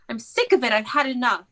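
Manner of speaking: ordinary talk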